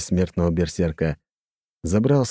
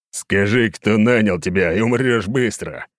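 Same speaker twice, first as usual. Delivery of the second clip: growling threat; 2 semitones higher than usual. Acted. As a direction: growl the threat through teeth. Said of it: "прорычал я".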